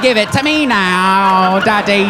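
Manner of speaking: slow, demanding spoiled brat; spoiled Veruca. Retold or imitated. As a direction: demand it like a spoiled rich girl. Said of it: "Veruca from the Chocolate Factory".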